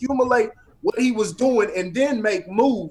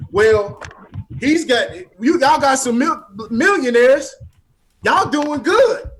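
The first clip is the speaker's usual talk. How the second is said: mocking; 5 semitones higher, 5 dB louder than usual. Mimicking people who praise millionaires.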